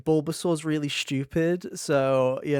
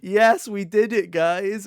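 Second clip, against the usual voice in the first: higher, louder and slow, cheery triumph; cheerful Charmander; 6 semitones higher, 7 dB louder, slower than usual. Retold or imitated. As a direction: celebrate like a proud lazy friend. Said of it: "he's like".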